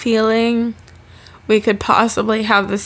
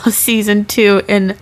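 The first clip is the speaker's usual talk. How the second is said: ordinary talk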